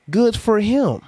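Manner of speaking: high, dismissive good for him; mock cheer; sarcastic. Real, not acted. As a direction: brush it off with sarcasm. Sarcastic reply.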